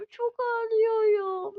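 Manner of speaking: slow, whimpering pretend sob; hammed-up crying patient; mock crying. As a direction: fake a cry through the words.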